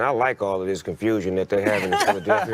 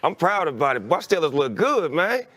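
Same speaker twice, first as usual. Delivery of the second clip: higher, building cheer for his team; proud fan crowing about his team, faster at the end; excited bragging; 8 semitones higher than usual. Real, not acted. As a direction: gloat about the Steelers, rising and speeding up.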